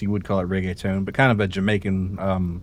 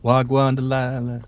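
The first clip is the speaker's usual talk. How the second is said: put-on Jamaican accent; 4 semitones higher, slower than usual. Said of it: "Jamaican accent".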